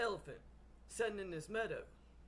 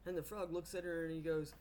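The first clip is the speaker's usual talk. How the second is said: ordinary talk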